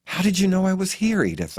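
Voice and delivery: high question, dropping on the name; marveling. A narrator's reading. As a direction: ask in wonder. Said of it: "I marvel".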